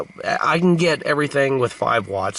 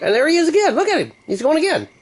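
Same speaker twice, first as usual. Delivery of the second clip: much higher, louder gleeful calling; excited; 4 dB louder, faster than usual. Real, not acted. Watching the bug run laps again, gleeful and high. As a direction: cheer the bug on.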